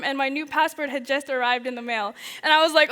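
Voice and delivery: ordinary talk, nothing marked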